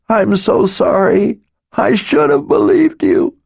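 weeping. A narrator's reading. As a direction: pour it out through tears. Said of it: "She wept in utter misery".

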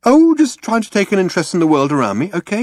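high, quick brush-off; defensive. A narrator's reading. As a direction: dismiss the question hurriedly. Ford fends off Arthur's question.

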